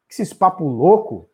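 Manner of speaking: ordinary delivery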